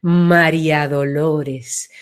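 María Dolores low and slow, widened; broad, deep name. Shown as a demonstration. Coach demonstrating a widened name, low and slow. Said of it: "se ensancha".

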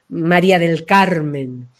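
María del Carmen said low and slow; grand full name. Shown as a demonstration. Voice coach savouring the full name, low and slow. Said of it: "te ha tocado".

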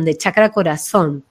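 ordinary talk, nothing marked

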